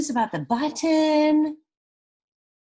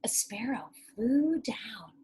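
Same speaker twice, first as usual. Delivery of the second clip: usual speaking voice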